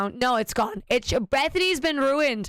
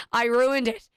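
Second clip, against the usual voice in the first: ordinary delivery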